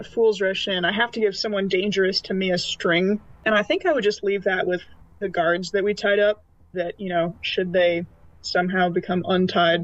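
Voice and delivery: usual speaking voice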